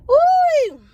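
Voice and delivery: a loud high whoop of joy; shopping-spree cheer, loud and high; excited whoop. Real, not acted. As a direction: let out a real cheer. Celebrating her shopping spree.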